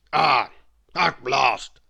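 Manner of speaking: loud, grumpy exclamation; a cross old man finally getting served; loud grumpy grumble; grumbling. A narrator's reading. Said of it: "grumbled Mister Grumble".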